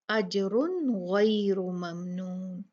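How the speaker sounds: अजरुन गैरु ममनून recited slowly, noon pronounced clearly; slow tajweed reading with clear noon; अजरुन गैरु ममनून with clear izhar; clear izhar recitation. Shown as a demonstration. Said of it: "इजहार करेंगे".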